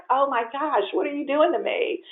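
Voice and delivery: playful groan of mock despair; retold comic dismay at husband; mock exasperation.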